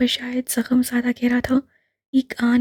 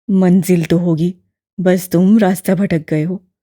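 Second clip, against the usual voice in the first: put-on low, louder elderly man, gently probing; lower, fuller old gentleman; old imam voice; 6 semitones lower, 6 dB louder than usual. A narrator's reading. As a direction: voice the old man, kindly and curious.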